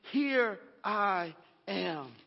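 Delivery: slow, hushed reply to God; humble Abraham, very slow; reverent answer. Acted. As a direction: answer like a servant ready to obey. Abraham answering God's call.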